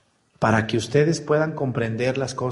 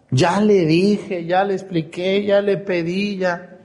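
exasperated pleading; 6 semitones higher, slower than usual. Retold or imitated. Preacher voicing a parent fed up with a son, pleading. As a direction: lay out each try with mounting frustration.

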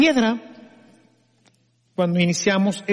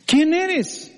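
startled question; 8 semitones higher than usual. Acted. Startled climber asks.

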